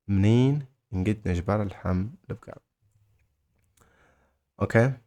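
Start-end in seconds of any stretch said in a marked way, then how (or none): 0.1-2.6 منين set apart by a pause, then the rest in pieces; broken-down phrase. Shown as a demonstration. Teacher modelling where to find beef, broken into parts. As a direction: separate منين and البقر from the words between.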